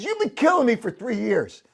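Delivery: retold complaint, mildly hammed-up; mock angry manager. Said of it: "He says".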